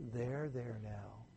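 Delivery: low, slow, hushed soothing; a mother's soft reassurance; comforting voice. Acted. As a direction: soothe a hurt child softly, slowly. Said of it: "Speaks in a comforting voice".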